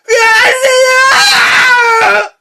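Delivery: high loud laughing; shrieking laugh. Real, not acted. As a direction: laugh helplessly.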